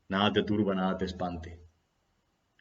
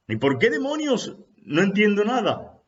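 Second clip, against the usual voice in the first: exasperated disciple; 12 semitones higher, 6 dB louder than usual. Acted.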